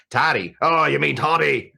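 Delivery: playful imitation of a Scottish accent; Scottish accent with toddy said flat and short; mock Scottish accent. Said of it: "the Scottish got a hold of them with that accent".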